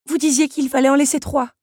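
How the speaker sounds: put-on high, loud protest from the young heroine; objecting. A narrator's reading. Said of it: "objecta-t-elle à la comtesse". (protestation aiguë et forte)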